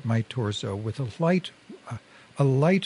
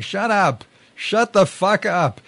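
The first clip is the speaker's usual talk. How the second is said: high, loud, furious yell; angry high-pitched scream; screaming back; 8 semitones higher, 6 dB louder, slower than usual. A narrator's reading. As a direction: scream it back, drawing out the second order. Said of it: "He screamed back".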